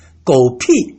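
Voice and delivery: sharp, low angry curse; yelled curse. Shown as a demonstration. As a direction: spit the curse out at someone. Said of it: "yell at this person".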